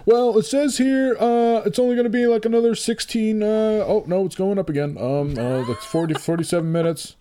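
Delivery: high whiny voice. Pretend. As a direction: whine it high like an anxious kid. Mock whining about slow download.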